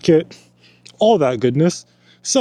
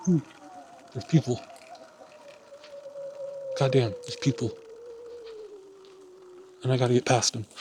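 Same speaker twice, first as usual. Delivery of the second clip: hushed voice under breath; 13 semitones higher, 14 dB quieter, faster than usual. Real, not acted. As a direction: keep it down, passing people. Sneaking past hikers.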